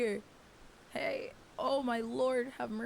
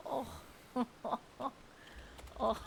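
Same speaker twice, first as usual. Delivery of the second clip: usual speaking voice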